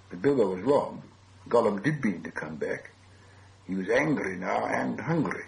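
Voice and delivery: ordinary delivery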